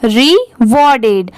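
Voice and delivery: slow rewarded, split "ree... war-ded"; syllable-by-syllable model. Shown as a demonstration. Teacher models the new word for pupils, slow and split. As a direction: draw out rewarded with a break after REE. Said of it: "आप भी मेरे बात pronounce करिए".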